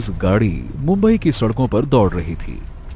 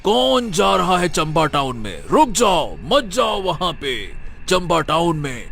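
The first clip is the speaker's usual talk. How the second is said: mock dramatic news anchor, pitched higher; breaking news style; 9 semitones higher than usual. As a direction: ham up a breaking-news warning. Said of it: "एकदम breaking news style में".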